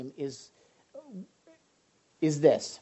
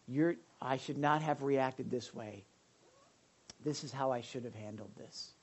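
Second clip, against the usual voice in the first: sincere apology; 8 dB quieter than usual. Shown as a demonstration. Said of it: "then you go and you just say".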